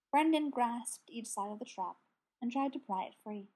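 ordinary delivery

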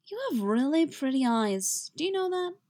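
high, woozy, wandering lilt; dazed and tipsy admiration; drunk-sounding. A narrator's reading. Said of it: "sounding distinctly similar to how he does when he's drunk".

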